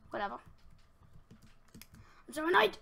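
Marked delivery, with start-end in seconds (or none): 2.3-2.8 loud, higher, exasperated; real frustrated shout. Real, not acted. Player fed up with his mistake, shouting. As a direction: blurt the annoyance out loud. Frustrated after wasted items.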